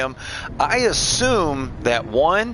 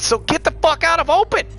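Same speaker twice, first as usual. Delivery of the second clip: retold yell; 12 semitones higher than usual. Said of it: "I said".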